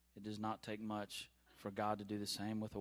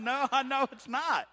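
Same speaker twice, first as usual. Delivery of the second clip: laughing reply; 13 semitones higher, 11 dB louder than usual. Retold or imitated. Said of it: "I was dying laughing".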